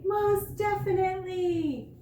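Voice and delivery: put-on the boy bursting with eagerness to go brush; excited exclamation. A narrator's reading. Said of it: "exclaimed excitedly".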